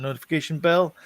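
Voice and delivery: ordinary delivery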